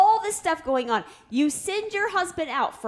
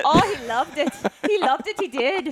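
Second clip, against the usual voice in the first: lilting, teasing mock Scottish; mock Scottish accent; 2 semitones higher than usual. Mom insists dad loved the accents, mock Scottish. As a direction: tease him in a put-on Scottish accent. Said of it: "these two talking to each other".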